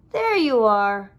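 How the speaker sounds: loud, slow welcoming call. A narrator's reading. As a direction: call across the meadow. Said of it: "called his friends".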